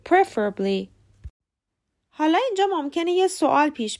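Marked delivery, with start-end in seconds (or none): 0.1-0.9 PREFerably, stressed at the start; correct first-syllable stress. Shown as a demonstration. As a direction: land on PREF in "preferably". (استرس preferably روی سیلاب اول)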